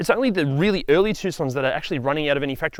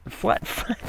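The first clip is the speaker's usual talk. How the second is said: laughing; 4 semitones higher, 5 dB quieter than usual. Real, not acted. Breaks up repeating a word.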